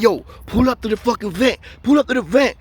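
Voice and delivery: high, carrying call through the vent. Retold or imitated. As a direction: shout it up the vent to the neighbor.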